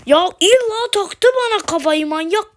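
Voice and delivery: high loud angry complaint; exasperated yell. Real, not acted. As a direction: shout at the stalker in disbelief. Enemy keeps chasing him.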